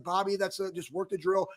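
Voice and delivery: flat, low monotone retort; dead flat, bored monotone. Retold or imitated. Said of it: "chirping back at Bob in a very, like, monotone voice".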